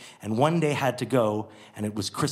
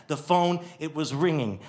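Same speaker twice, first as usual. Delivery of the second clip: plain reading voice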